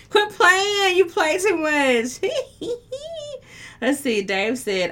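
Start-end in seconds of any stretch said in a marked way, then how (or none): 0.1-3.8 loud, high squeal through real laughter; laughing protest. Real, not acted. Host teased by a flirty comment, laughing high and loud. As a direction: squeal it while cracking up.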